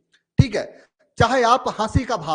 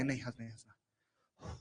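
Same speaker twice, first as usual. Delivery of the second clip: trying not to laugh; 10 semitones lower, 19 dB quieter than usual. Acted. Teacher acts holding back laughter.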